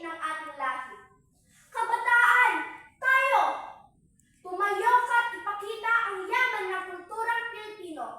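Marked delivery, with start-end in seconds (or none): 1.7-7.5 loud, high call to the crowd; rallying cry. Acted. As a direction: call the youth to rise, at full volume. Climactic rallying call to youth.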